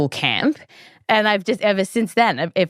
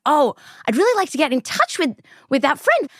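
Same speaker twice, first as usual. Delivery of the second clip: eager wish; 9 semitones higher, faster than usual. Retold or imitated. Retelling own wish to reconnect.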